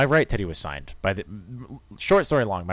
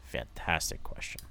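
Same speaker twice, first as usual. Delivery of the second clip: dodging question; 3 semitones lower, 8 dB quieter than usual. Retold or imitated. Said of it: "I was like".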